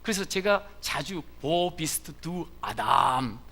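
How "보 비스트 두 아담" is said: imitating deep teacher. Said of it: "저렇게 멋지게".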